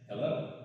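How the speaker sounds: "Hello" with a rising question tune. A narrator's reading. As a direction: say "Hello" tentatively, lifting at the end like a question. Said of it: "those hellos he chirped like questions".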